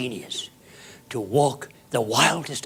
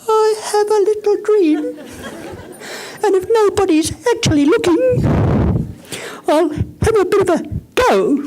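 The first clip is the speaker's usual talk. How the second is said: mock a small, high, timid voice, much higher and louder; timid little voice; 7 dB louder than usual. Speaker mocking a timid dreamer, high and squeaky. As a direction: squeak it out like someone too shy to mean it.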